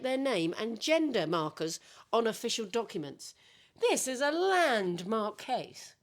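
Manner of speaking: laughing through a news read; half-giggling anchor voice; newsreader voice. Acted. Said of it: "Yana doesn't like my tone".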